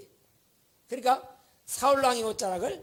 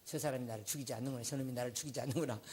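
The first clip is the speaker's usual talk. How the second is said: Saul muttering to himself; 8 semitones lower, 12 dB quieter, faster than usual. Retold or imitated. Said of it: "사울이".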